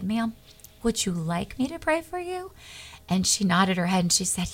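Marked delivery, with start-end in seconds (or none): 0.0-2.5 softer, gentle murmured offer; quiet. Retold or imitated. Sitting beside a woman in the lobby, asking quietly. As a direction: lean in and ask under your breath. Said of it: "very quietly and I said".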